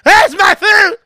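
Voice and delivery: high, loud, slow whiny shout; husband bellowing at wife for dinner, mock whiny shout; hammed-up demanding husband. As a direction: yell for your dinner like a spoiled husband. Imitating Sam demanding food.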